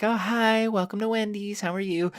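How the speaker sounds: a cashier greeting you brightly; mock cheery cashier. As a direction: imitate a chirpy fast-food welcome. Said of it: "instead of saying, like".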